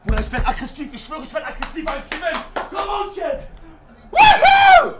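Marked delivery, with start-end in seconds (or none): 4.1-4.9 loud, very high victory whoop. Real, not acted. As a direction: let out a piercing cheer. Celebrating while winning.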